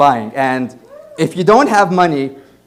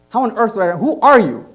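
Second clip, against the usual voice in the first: exasperated; 7 semitones higher, 4 dB louder than usual. Retold or imitated. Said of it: "And I'm like".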